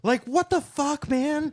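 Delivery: indignant cry at sloppy editing; exasperated outburst. Retold or imitated. Ranting about typos.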